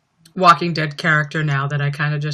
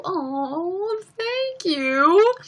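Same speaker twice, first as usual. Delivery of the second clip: squeaky sweet thanks; 11 semitones higher, 3 dB quieter, much slower than usual. Acted. Reacting to "we all love you.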